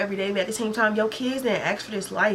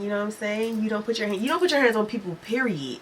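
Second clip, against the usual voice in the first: ordinary talk, nothing marked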